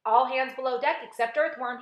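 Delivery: loud commanding order. A narrator's reading. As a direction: give the order like a captain. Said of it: "he ordered".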